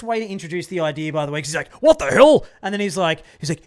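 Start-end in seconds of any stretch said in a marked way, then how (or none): 1.8-2.5 high, loud burst of indignation; hammed-up angry movie guard; mock outraged guard. Guard's angry reply, acted out.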